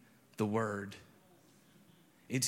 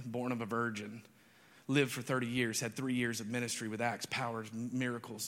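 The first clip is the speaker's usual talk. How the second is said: normal talking voice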